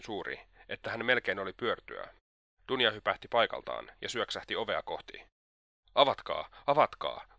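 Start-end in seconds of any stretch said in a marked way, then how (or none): 5.9-7.3 loud, urgent call to open up; crying out. A narrator's reading. Dunja calls for help at the locked door, crying out. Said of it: "huusi hän".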